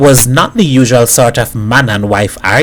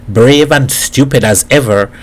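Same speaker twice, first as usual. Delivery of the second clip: ordinary delivery, nothing marked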